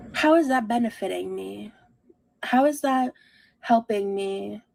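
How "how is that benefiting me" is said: ordinary talk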